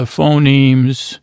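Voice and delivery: ordinary talk, nothing marked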